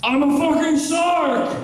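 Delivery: high, slow mock shark shout; shark impression. Comic showing his animal impressions, hammed-up high shark shout. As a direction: yell it as a deliberately bad shark impression. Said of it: "Here's my shark impression".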